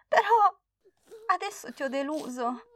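put-on high tearful child; a hint of sobbing apology; crying girl. Girl cries to grandma she let her down, high tearful voice. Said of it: "scoppiò nuovamente a piangere".

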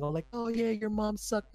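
high, squeaky mocking kid voice. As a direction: taunt like a squeaky gamer kid. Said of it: "the hype fucking squeaky voice saying".

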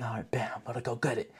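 hushed, half-voiced pep to himself; whispered mock resolve. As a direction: whisper it like a secret plan.

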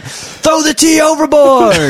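high, drawn-out hammed-up shout; mock rebel yell. Co-host acting the tea rebellion, mock rallying shout. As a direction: call it out long and high, like a rallying cry. Acted colonial rebellion, calling out.

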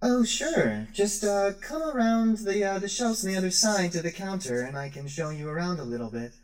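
high, bright droid voice; sweet robot shopkeeper. Acted. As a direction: welcome the customers warmly. Rosie the droid answers.

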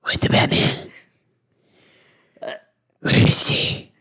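forceful hoarse whisper, no voice; Batman. Pretend. Parody hero demanding where someone is, raspy whisper. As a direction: spoof a menacing superhero rasp. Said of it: "I try to go for that".

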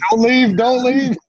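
high, loud, slow playful plea; hammed-up begging them to stay; mock panic. Co-host joins the joke.

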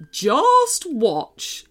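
put-on teddy voice, confident; insistent teddy. A narrator's reading. Teddy Marshall readies his magic, insistent. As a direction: insist on it in character. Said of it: "insisted Marshall".

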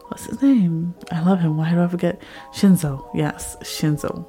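ordinary talk

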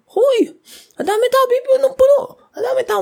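high, excited gasp at the crowd; amazed inner voice. Retold or imitated. Dancer recalling her shock at the crowd, high amazed squeal. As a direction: squeal in disbelief at the packed arena.

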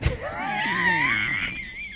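very high camp shrieking laugh; high shrill cackle. Retold or imitated. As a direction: cackle high like a shocked diva.

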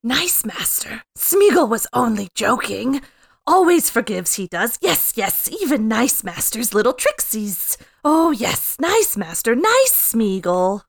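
high, loud, wheedling creature voice; Gollum. Acted. Podcast host reads a Gollum line to friends, wheedling creature voice. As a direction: fawn on the master with swooping emphasis. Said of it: "Let's all pick a Gollum line".

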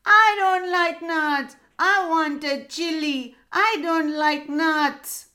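high, loud, tearful little parrot; crying parrot. A narrator's reading. Parrot in the tree refusing nuts, crying. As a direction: whine through tears.

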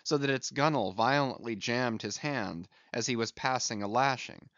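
ordinary delivery

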